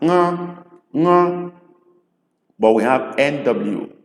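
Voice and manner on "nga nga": "nga" on a high, level tone; dotted N as "nga". Shown as a demonstration. Igbo teacher showing tongue position, "nga" sound. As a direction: model the "ng" of dotted N, held high. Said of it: "this sound".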